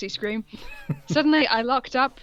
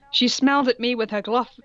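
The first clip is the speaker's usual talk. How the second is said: normal talking voice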